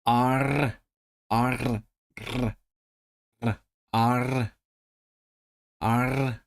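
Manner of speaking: practising R with a rolled ending; trilled R. Shown as a demonstration. Learner drilling the sound alone, trilled R. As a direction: roll the tongue on each R. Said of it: "they do use the trilled R".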